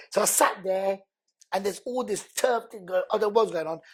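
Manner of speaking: ordinary delivery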